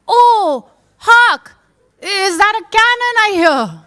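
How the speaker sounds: high, loud theatrical proclamation; hammed-up delivery of a forgotten line; belated stage declamation. Actor remembering his cue too late, declaiming loudly.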